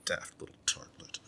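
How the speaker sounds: slow, soft, then whispered insult; contemptuous whisper. Acted. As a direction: hiss the insult under the breath. Player insults his mother.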